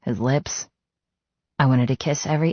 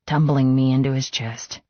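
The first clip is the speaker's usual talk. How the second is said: ordinary delivery, nothing marked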